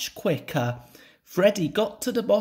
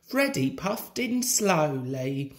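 ordinary delivery, nothing marked

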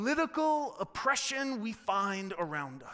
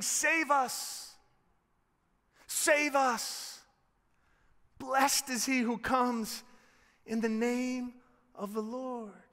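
raised, spaced-out plea; crowd calling out; 3 semitones higher, slower than usual. Acted. Crowd as Jesus rides in, calling out. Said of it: "they're saying".

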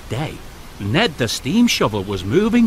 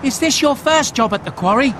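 higher, called-out question; calling Thomas; 8 semitones higher than usual. A narrator's reading. Thomas calls to Jack on the way, higher called question. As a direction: call across to the new friend. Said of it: "called Thomas".

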